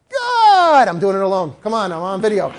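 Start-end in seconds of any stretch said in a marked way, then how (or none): 0.1-0.9 loud, high, long cheer; happy, excited. Shown as a demonstration. Trainer demonstrating praise alone on video, excited cheer. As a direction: burst out with delighted praise. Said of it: "Happy, excited".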